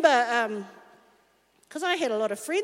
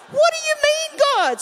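surprised protest; 12 semitones higher, 3 dB louder than usual. Retold or imitated.

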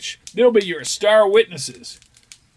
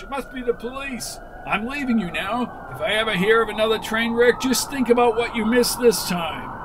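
ordinary delivery

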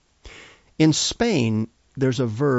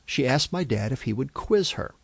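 ordinary delivery

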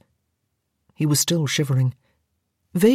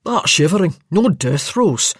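impatient; 3 dB louder than usual. Acted. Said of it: "said impatiently".

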